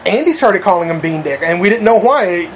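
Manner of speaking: usual speaking voice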